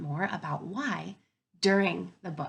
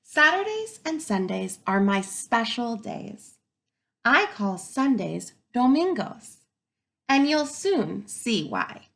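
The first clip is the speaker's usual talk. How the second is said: slow, clear picture-book reading; reading to children; 2 semitones higher, 5 dB louder, slower than usual. Acted. Teacher reading a picture book to her students, slow and clear. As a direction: read the story out to the class.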